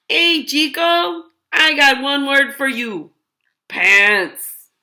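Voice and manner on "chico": ordinary delivery, nothing marked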